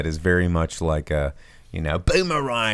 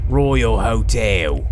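dramatic title read; 2 semitones higher, 4 dB louder, much slower than usual. Shown as a demonstration. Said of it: "title drawn out again".

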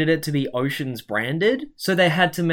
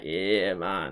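stilted fake Jamaican; 8 semitones lower, 9 dB quieter than usual. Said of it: "fucking stilted".

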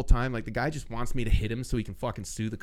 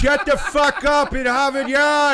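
high, loud shout, laughing through it; 13 semitones higher, 9 dB louder than usual. Retold or imitated. Storyteller shouting the heckler down, high, loud and laughing. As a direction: blast him with a laughing yell. I'm like.

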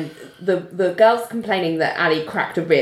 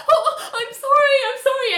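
mock distress. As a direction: plead in a high, put-on fluster. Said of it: "is just really distressed".

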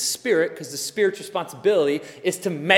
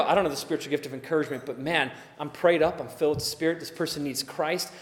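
quiet inner resolve; 5 semitones lower, 7 dB quieter than usual. Retold or imitated.

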